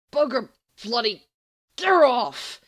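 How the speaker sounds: loud, rising yelp of protest. Acted. Sirius yanked into the air by Remus, loud yelp. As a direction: yelp as you're yanked off your feet. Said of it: "Sirius yelps".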